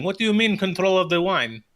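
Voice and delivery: alarmed villagers. Acted. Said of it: "the Barovians are turning their heads going".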